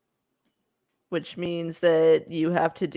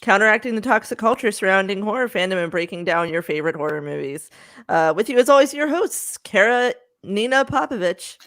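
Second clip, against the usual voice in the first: podcast intro read; 3 semitones higher, 4 dB louder than usual. Acted. Hosts read the show intro.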